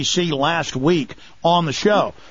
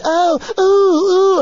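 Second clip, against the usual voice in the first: much higher, louder hammed-up cooing; mock thrilled oohs; 13 semitones higher, 5 dB louder, much slower than usual. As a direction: ape the crowd gasping in delight. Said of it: "jumping, 'cause it's so much".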